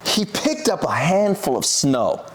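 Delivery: ordinary delivery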